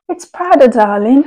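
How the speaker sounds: put-on camp it up in a British accent, darling; posh English accent. English accent, teasing the viewers about her orange drink. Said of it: "Je suis obsédée par l'accent anglais".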